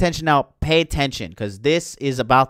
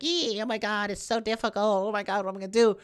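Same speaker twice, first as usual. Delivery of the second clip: high, strained, softer whiny squeak; mock struggling Rey; 6 semitones higher, 6 dB quieter, much faster than usual. Rey blocking the lightning, mock strained high whine. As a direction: whimper like it's way too hard. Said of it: "She's like".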